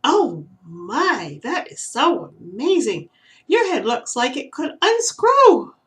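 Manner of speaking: put-on young squirrel marvelling at the owl; amazed squirrel. A narrator's reading. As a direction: gush with wide-eyed wonder. Squirrel amazed by owl's head.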